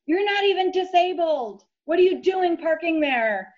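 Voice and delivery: accusing man's yell, retold. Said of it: "this man starts yelling at me".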